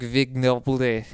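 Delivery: slow, drawn-out French accent. Shown as a demonstration. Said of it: "I'll give it another shot".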